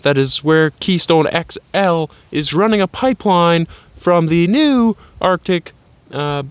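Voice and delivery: hammed-up on-air forecast, words punched out; mock weather reporter. Man outdoors reporting on the southern wind, mock weather reporter.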